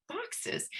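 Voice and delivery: whispered, high, disbelieving Boxes?; a stunned, breathy Boxes? asked up high; incredulous question. Retold or imitated. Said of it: "I'd never heard of that".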